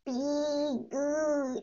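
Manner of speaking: soft, croaky alien whisper. Retold or imitated. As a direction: quietly imitate E.T. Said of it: "It was like, it was like this".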